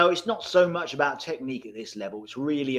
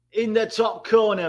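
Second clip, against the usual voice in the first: higher, punchier call of the goal; excited call; 8 semitones higher, 4 dB louder than usual. Real, not acted. Streamer repeating his goal call, excited. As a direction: hype the finish as you repeat it.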